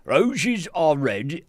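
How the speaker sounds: high, loud, very slow barbarian bellow. Acted. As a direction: boom it out like a hulking barbarian poet. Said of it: "the barbarian voice".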